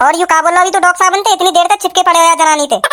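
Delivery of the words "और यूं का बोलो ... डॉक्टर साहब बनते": ordinary talk, nothing marked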